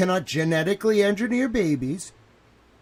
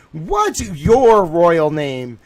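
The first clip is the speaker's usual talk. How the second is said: loud confrontation; 7 dB louder, much slower than usual. Retold or imitated. Confronting donor on phone call.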